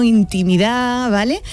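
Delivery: usual speaking voice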